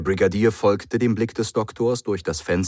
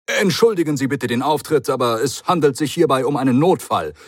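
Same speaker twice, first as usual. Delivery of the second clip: a hint of a brisk, chatty Doctor; airy reassurance, talking fast past it; lightly voiced breezy Doctor; 7 semitones higher, 5 dB louder than usual. A narrator's reading. The Doctor brushes it off to the Brigadier, lightly voiced and breezy.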